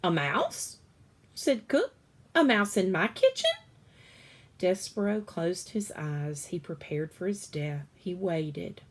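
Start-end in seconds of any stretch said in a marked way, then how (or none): 0.0-3.5 high, loud disbelieving cry; loud surprised disbelief. Acted. Cook shocked to find a mouse, loud high disbelief.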